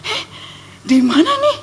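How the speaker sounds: high, startled bewilderment, acted out. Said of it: "saya langsung kaget".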